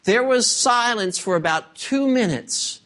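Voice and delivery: ordinary talk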